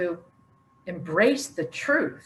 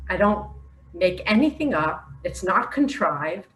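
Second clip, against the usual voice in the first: ordinary talk, nothing marked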